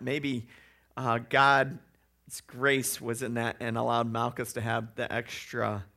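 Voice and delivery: ordinary talk, nothing marked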